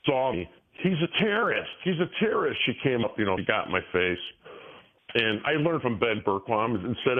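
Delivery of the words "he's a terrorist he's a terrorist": high mocking repeat of the accuser; imitating the woman shouting him down; mock accusing woman. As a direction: parrot her accusation in a raised voice. Woman accosting him, retold.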